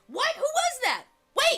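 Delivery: high, loud alarmed questions; startled yelling. Acted. Game master as Wesley startled by voice in ear, alarmed. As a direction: blurt it out loud and high, baffled. Startled character reacts to tiny voice.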